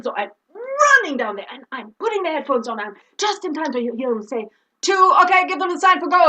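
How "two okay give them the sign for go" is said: loud, raised director's command; called-out cue. Retold or imitated. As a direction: call the countdown cue into the headset. Director calling cue over headphones.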